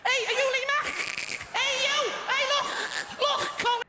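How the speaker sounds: put-on very high squeaky Scouse accent; absurdly high-pitched Scouser turning dolphin. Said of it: "so Scouse it turns into a dolphin".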